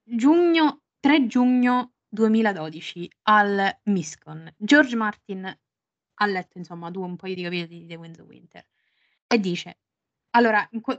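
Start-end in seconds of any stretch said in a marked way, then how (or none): none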